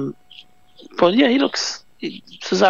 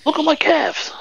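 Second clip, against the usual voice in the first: much higher silly voice; high mock boast; 12 semitones higher than usual.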